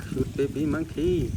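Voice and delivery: ordinary talk, nothing marked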